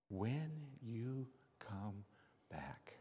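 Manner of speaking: very slow and soft, word by word; hushed, drawn-out reflection; slow pondering. Acted. Narrator ponders the remembered words.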